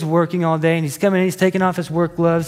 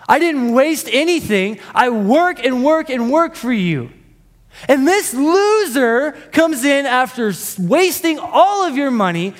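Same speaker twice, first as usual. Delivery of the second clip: put-on indignant older brother; 6 semitones higher than usual. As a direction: rant at the father about fairness. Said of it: "So we have an angry brother".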